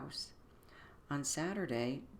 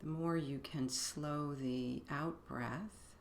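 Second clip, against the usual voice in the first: guided meditation; 4 dB quieter than usual. Acted. Begins leading the meditation.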